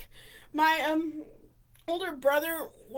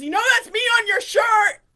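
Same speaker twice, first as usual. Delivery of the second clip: loud, high imitation of an indignant guard; retold yell of protest; confronting shout; 7 semitones higher, 11 dB louder than usual. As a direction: re-enact an outraged accusation. Security guard confronts in story.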